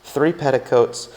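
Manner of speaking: plain reading voice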